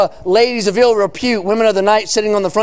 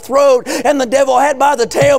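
driving, sustained revival sermon cadence; full-force preaching; 3 semitones higher than usual. Real, not acted. Preacher proclaiming salvation to the congregation, full-force preaching. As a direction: preach it hard, stretching the key words.

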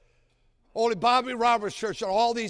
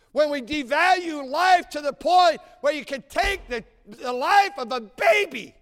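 louder, higher preaching cry; impassioned preaching; 6 semitones higher, 7 dB louder than usual. Acted. Preacher condemning abortion to the congregation, impassioned.

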